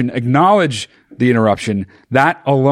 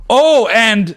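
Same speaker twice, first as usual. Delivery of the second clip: high, pushy interjection; abrupt butting in; 9 semitones higher, 3 dB louder than usual. Shown as a demonstration. Showing co-host the wrong way to interrupt, high abrupt cut-in. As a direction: cut in with no apology at all. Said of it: "You're not just like".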